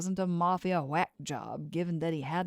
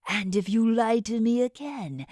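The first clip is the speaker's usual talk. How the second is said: menacing threat; 4 semitones higher, 5 dB louder than usual. Acted. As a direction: lean in and threaten. Monster threatens Bartley.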